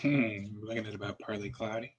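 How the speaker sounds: soft, calm radio weather voice; calm weather report. Acted. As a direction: read it like a soothing radio forecaster. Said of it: "Listen to that calm and soothing voice".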